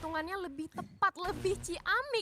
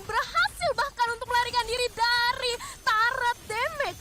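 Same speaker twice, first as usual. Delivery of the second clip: excited; 6 semitones higher, 8 dB louder than usual. Real, not acted. Caster hypes a big escape.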